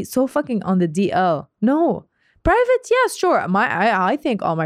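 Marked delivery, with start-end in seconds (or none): 1.6-3.5 sarcastic disbelief. Real, not acted. Scoffing at couples calling hidden love private, sarcastic. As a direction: roll your eyes at the excuse.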